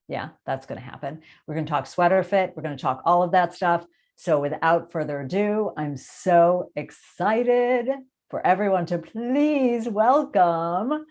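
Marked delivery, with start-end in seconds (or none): 5.8-11.0 drawn-out, excited build-up; host's big introduction. Real, not acted. Host welcomes her guest to the show, excited fanfare. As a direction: stretch the words out like an emcee.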